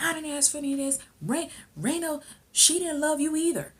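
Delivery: high-pitched mock retort; retold protesting son. Son hits back at father, high protesting imitation. Acted son's retort in quarrel.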